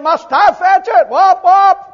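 loud, high, slow angry complaint; frustrated Moses shouting at God; Moses' exasperated shout. Retold or imitated. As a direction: bark it out fed up, then mimic the strikes. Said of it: "He said".

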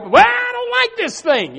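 high, loud whiny complaint; squeaky griping voice; mock whine. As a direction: whine it like a sulky churchgoer. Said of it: "we can whine about it, we can gripe".

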